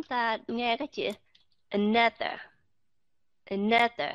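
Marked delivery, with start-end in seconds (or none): 1.7-4.1 another with a light, reduced schwa on the first a; weak schwa. Shown as a demonstration. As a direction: glide over the a in another. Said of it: "cái âm ờ ở phía đầu đó".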